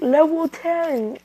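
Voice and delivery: high, drawn-out power-level shout; attack call. Acted. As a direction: call out the biggest move, stretched out. Naruto calls final attack level.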